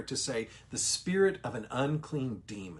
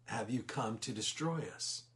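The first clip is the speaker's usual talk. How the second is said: softer, lower, fearful demon; 3 semitones lower, 8 dB quieter than usual. Retold or imitated. Demon afraid before the Lord, asking softly. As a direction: shrink back as you ask. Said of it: "in fear".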